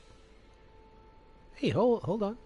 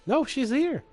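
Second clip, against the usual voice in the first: sudden high loud exclamation; surprised; 13 semitones higher, 5 dB louder, slower than usual. Real, not acted. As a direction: blurt it out in surprise. Surprised as NPC reappears.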